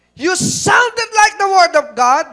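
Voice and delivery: loud, high preaching, rising at the end. Shown as a demonstration. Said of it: "we do not follow the voice of God". (malakas na pangangaral)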